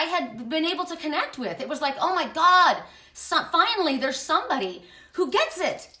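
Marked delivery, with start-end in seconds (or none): 2.0-6.0 high, loud burst of relief; emphatic, elated outburst; excited relief. Retold or imitated. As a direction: exclaim it as a retold revelation. Retelling relief at finding kindred person.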